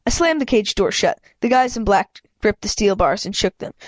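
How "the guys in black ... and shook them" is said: ordinary delivery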